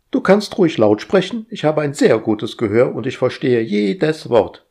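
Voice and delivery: high, bossy, busy voice; fussy household robot. Acted. Household AI fussing at Roger, high and bossy. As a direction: nag like an overeager house AI.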